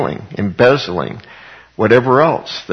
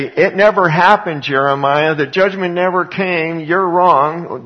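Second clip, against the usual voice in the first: higher, louder mock taunt; mocking accusers; 7 semitones higher, 4 dB louder than usual. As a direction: taunt Jeremiah as the accusers would. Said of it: "don't let them say".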